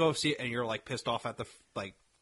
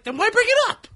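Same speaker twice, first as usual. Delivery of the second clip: high, loud incredulous question; mock-outraged yelp; exasperated outburst; 9 dB louder than usual. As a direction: demand it, voice shooting up. Acted exasperation at the plot.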